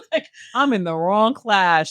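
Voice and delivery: laughing through the line, slowed down; real laughter as she says it; amused, laughing it out. Real, not acted. Laughing at her own story.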